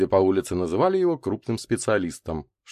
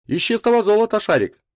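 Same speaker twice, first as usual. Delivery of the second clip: high, loud teasing claim; mock businesslike; 14 semitones higher, 7 dB louder than usual. A narrator's reading. Славка winks at Микульский while teasing, mock businesslike. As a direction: sell the joke with mock seriousness. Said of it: "деловито нахмурившись и подмигивая".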